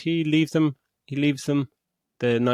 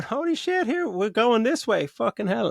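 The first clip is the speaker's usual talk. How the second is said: high, thrilled exclamation; excited shock; 13 semitones higher, 3 dB louder than usual. Retold or imitated. A fan seeing the Elektra cameo, high and thrilled. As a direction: blurt it out, pitched way up. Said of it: "And you're going".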